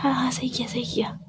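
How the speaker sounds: hushed, barely voiced answer; real whisper. Real, not acted. Girlfriend secretly calling at 1 a.m., real whisper. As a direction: whisper so the family next door cannot hear. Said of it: "इसलिए धीरे धीरे बोल रही हूँ मैं".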